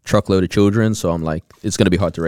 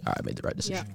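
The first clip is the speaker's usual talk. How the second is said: whispered to himself; 10 semitones lower, 16 dB quieter, much faster than usual. Retold or imitated. Inner thought after breakup decision.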